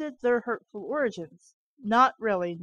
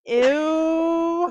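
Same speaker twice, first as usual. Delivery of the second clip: loud, grossed-out ew; disgusted ew; 3 semitones higher, 8 dB louder than usual. Real, not acted. Reading about angels' blood, a loud disgusted ew. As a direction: recoil from the gross line.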